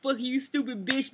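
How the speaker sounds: hammed-up furious yelling at the boyfriend; imitating a yelling girlfriend. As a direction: play her cussing him out after a hard day. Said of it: "you giving him hell in the car".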